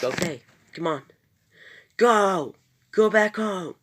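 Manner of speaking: high, loud urgent yell; yelling at Sarah. Retold or imitated. Jonathan yelling at Sarah to go back home, loud urgent shout. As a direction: drive her away in a rush, shouting. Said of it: "He yells that at Sarah".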